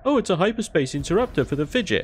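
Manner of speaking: high, fast mocking announcement; lightly voiced sarcastic loot reveal; mock game voice. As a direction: parody the loot popup cheerily. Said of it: "and then it goes".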